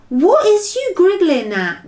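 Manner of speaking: high, loud, put-out question; slightly nettled. A narrator's reading. BFG asking smiling Sophie, slightly nettled. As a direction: demand it, a bit offended. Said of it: "slightly nettled".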